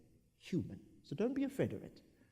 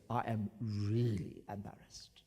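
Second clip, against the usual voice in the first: lower, slow sheepish admission; 7 semitones lower, 4 dB quieter than usual. Shown as a demonstration. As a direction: confess it a bit shyly. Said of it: "this one here is trying to say".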